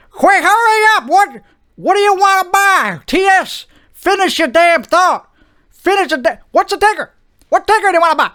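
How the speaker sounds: high-pitched, pushy Wall Street broker; impatient stockbroker. Acted. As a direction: hurry the customer along in a high voice. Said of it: "I'm a stockbroker".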